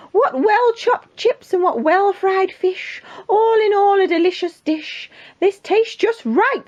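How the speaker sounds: high, drawn-out royal praise; delighted king. Acted. King praising the cook's meal, high delighted voice. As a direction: savour it with storybook delight.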